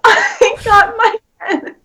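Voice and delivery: high-pitched, drawn out through laughter; laughing hard. Real, not acted. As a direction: struggle to get it out while laughing. Breaking up telling own story.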